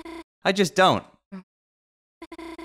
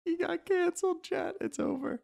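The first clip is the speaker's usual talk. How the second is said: very high and very soft; tiny squeaky voice; 13 dB quieter than usual. Real, not acted. Streamer telling chat he got canceled, faint squeak. Spent from laughing, repeats to chat.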